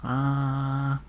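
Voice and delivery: open-mouthed ah with the tongue out. Shown as a demonstration. Patient told by doctor to say ah, tongue out. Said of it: "stick out your tongue and say, "Ah".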